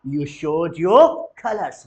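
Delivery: slow and loud, "your" hit hard; loud model phrase. Shown as a demonstration. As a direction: belt out "You showed your colors". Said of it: "बोलेंगे जरा". (ज़ोर से "You showed your colors")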